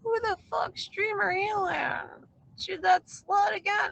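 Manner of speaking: high, slow, shrieking jealous accusation; jealous screech. Retold or imitated. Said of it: "She's like".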